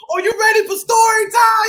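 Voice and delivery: high, loud, fast hype; excited host call. Acted. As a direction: pump up the kids. Host hypes kids for story time.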